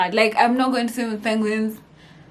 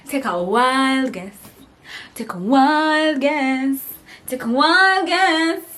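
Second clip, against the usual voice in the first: slow, drawn-out tease that builds higher each time; teasing challenge; 6 semitones higher, 6 dB louder, much slower than usual. Real, not acted. Vlogger teasing her viewers to guess who she saw, building playful dare.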